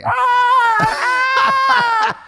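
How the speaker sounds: high, loud crying scream; pained shriek. Retold or imitated. Mother on the floor screaming in pain, high and loud. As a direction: wail after a fall.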